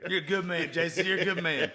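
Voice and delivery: laughing. Real, not acted. Teasing praise through laughter.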